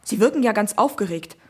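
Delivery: policeman's voice. Acted. Policeman on phone.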